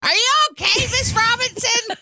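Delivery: put-on high-pitched kid checking on an adult; concerned schoolkid. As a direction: ask the teacher like a worried pupil. Plays pupil checking on chaperone.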